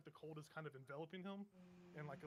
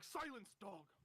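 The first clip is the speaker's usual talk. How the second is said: raised-pitch, commanding rebuke; sharp command; 5 semitones higher, much slower than usual. Acted. Man silences his pleading echo, sharp command. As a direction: cut them off with an order.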